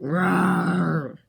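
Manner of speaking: hammed-up zombie roaring rawr, go go; primitive zombie roar. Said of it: "He speaks very primitively".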